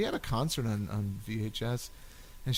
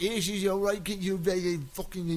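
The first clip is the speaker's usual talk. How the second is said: higher-pitched mock Cockney accent; 6 semitones higher than usual. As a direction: imitate a thick Cockney speaker. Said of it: "One of them was so cockney".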